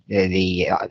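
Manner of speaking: ordinary talk, nothing marked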